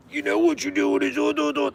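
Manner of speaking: a prissy officer lecturing; mocking a cop. Law enforcement lecturing the kids, high mocking voice.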